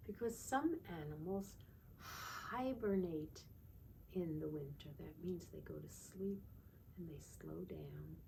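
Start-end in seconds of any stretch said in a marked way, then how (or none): 2.0-3.4 slow demo word. Shown as a demonstration. Teacher to children, hibernate drawn out slowly. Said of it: "Can you say that word?". 5.0-8.2 whisper. Acted. Describing sleepy animals.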